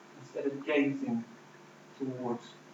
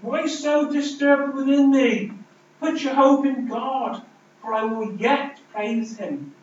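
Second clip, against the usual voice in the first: much higher, louder and slow, pleading with his own soul; anguished plea; 9 semitones higher, 7 dB louder than usual. Retold or imitated. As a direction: cry out to your own soul, slow and raised. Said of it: "David looks in himself and says".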